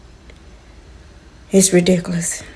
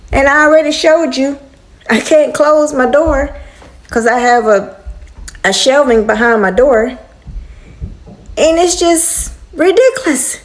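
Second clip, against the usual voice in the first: normal talking voice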